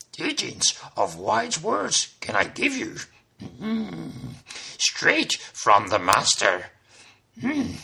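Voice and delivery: slow, odd-voiced little sage; slow, inverted sage speech; wise master. Shown as a demonstration. Yoda-like master impression.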